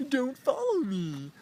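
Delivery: very high, slow mocking imitation; put-on high voice of a scolding woman; mimicked woman. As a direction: mimic her rebuke, drawn out and high. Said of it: "she's like".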